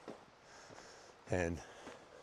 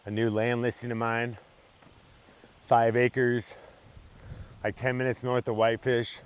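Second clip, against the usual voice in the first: sales pitch; 4 dB louder than usual. Acted. Pitches a land listing.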